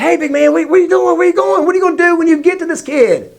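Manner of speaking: high, hurried questioning; playfully acted high-pitched alarm; urgent dad intervening. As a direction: stop a toddler with rapid high questions. Dad restraining toddler, acted.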